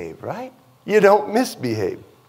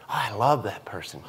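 low, hushed show of affection; soft mock profession; 9 semitones lower, 10 dB quieter than usual. Preacher mimicking Christians professing love, low and soft. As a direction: murmur it as a token profession of love.

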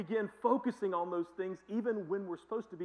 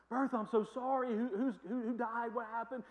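higher, anxious questions; a mildly raised, concerned voice; worried friend; 6 semitones higher than usual. Retold or imitated. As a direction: play the alarmed neighbour. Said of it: "Graham's like".

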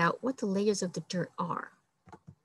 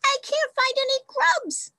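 much higher squeaky little beetle voice; tiny sad beetle. Acted. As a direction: squeak it like a tiny, miserable bug. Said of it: "a voice cried".

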